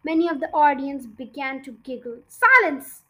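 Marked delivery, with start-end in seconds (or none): 2.3-3.0 high, loud commanding shout. A narrator's reading. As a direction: silence the giggling hall. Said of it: "shouted the Trunchbull".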